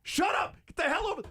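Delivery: very high squeaky mock yell, slow; a cartoonish coach barking at a colleague; high, loud comic tirade; mock Bieniemy yelling. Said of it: "Eric Bieniemy's like".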